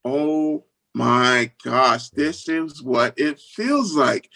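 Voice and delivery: very slow, stunned retelling; awed realization. Retold or imitated. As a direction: draw it out in wonder. Overwhelmed by crying young fans.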